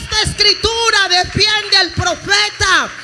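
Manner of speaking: the preacher's ringing restatement. Real, not acted. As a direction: push it up and out with full force. Repeated proclamation, higher.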